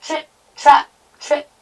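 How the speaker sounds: high, crisp, separated beats; light staccato trip-trap; hoofbeat sound words. A narrator's reading. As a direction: tap out each little hoofstep. Hoof sounds of little goat.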